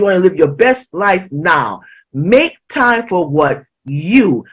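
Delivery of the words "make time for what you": slow build to a held YOU; measured, weighty "you"; stress on YOU. Real, not acted. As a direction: stretch "you" and let it stand out. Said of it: "the emphasis on you".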